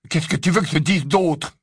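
exclamation défensive. Acted. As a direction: cry out defensively. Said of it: "s'écria Ron". (protestation d'une voix plus haute)

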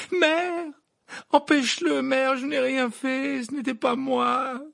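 high, loud drawn-out wail; panicked boy crying out; desperate pleading. Acted. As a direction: beg her to step in. Son begs mother to intervene.

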